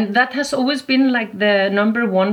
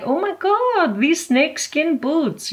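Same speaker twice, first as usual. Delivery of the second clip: high, gushing mock exclamation; excited MC; 8 semitones higher than usual. MC admiring a competitor's snakeskin boots, voice up high. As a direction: imitate the MC raving about the boots. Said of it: "he was like".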